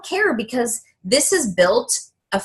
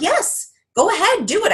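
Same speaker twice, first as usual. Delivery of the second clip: higher, bright cheering; cheerful encouragement; 10 semitones higher than usual. Retold or imitated. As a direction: cheer her on like a fan. Voicing supportive people.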